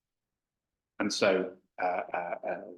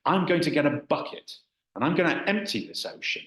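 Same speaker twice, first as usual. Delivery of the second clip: shouted threat; 7 semitones higher than usual. Retold or imitated. Man threatening the dragon he'll empty the ocean, shouting. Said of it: "the man shouted out".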